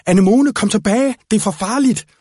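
high, loud urgent call back; shouting. Acted. Slåen shouts at Anemone to come back. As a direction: order them back, afraid for them. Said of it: "råbte han".

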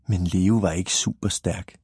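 ordinary delivery, nothing marked

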